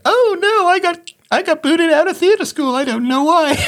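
very high, hammed-up teenage girl voice; mock teenage daughter. Dad imitating his teenage daughter reacting to his bad accent, mock squeaky voice. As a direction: squeal it as a parody of your daughter. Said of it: "my daughter is like".

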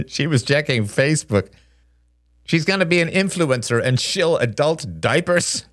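put-on high, snickering boy voice; chuckle, then make fun of your mother; gleeful kid mockery; mocking laugh. A narrator's reading. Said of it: "Justin laughed".

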